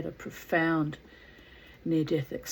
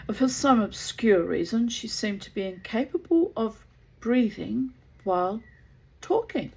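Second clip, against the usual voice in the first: louder, animated storybook reading with swooping pitch; theatrical story narration; 3 semitones higher, 4 dB louder than usual. A narrator's reading. Gerald's account of the hotel visit, animated story narration. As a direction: read it out with dramatic flourish.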